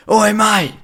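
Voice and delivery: high calling "oi mate"; homeless man's call. Retold or imitated. Homeless man yelling out to him, high mock call. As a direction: mimic a rough street call. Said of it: "He goes".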